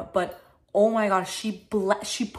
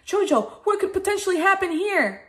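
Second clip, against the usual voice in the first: much higher interviewer voice; imitated commentator question, pitched way up; Cara; 10 semitones higher, 4 dB louder than usual. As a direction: pose it like an eager on-air co-host. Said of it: "Cara could have been like".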